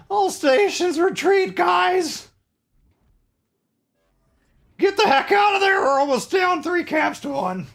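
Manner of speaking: high, slow, shouted retreat order; urgent shout. Real, not acted. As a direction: yell it to the whole team, urgently. Urgent team callout in match.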